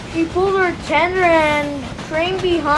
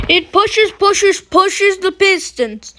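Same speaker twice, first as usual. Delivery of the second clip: higher and louder, driving narration; building excitement; 4 semitones higher, 5 dB louder than usual. A narrator's reading. Narrator telling how steam drives the pistons, building excitement. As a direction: push the words like pistons pumping.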